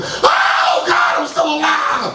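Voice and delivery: high cry of mock anguish; waking up and screaming at still being alive; despairing wail. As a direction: wail at the ceiling in comic despair.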